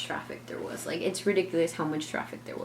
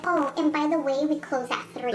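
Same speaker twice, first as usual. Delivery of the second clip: higher mock-sweet clerk voice; snide clerk; 9 semitones higher than usual. Narrator mimics the clerk adding closing time, higher. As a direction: toss it off like a gotcha.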